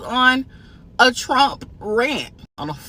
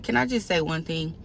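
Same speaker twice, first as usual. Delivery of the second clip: calm, soft reasoning; 5 semitones lower, 6 dB quieter than usual. Retold or imitated. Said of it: "I said".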